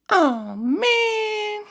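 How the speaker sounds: very high, loud cartoon whine; drawn-out squeal of mock disappointment; imitating little kid. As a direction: whine it like a small child copying a cartoon villain. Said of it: "when my kid did that".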